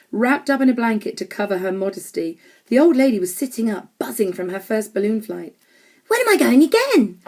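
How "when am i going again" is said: put-on high, lively old lady's question; bright. A narrator's reading. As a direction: pipe up eagerly, high and loud. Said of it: "she asked brightly".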